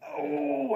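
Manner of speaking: high drawn-out "oh"; imitated pained cry. Teen trucker re-enacting getting hit, imitated pained cry. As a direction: pitch a pained "oh" way up. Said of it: "And I'm like".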